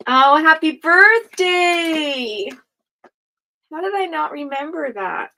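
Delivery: drawn-out delighted birthday wish; excited. Real, not acted. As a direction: stretch it out, thrilled. Reacts to a card, drawn out.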